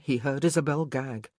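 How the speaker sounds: plain reading voice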